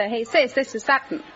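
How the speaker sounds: ordinary talk, nothing marked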